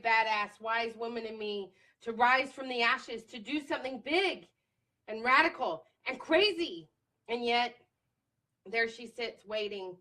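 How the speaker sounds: emphatic, spoken-word style recitation; impassioned journal reading. Real, not acted. Woman reading her post-election journal to viewers, impassioned. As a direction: declaim it with punched key words, building in force. Reads own journal entry aloud.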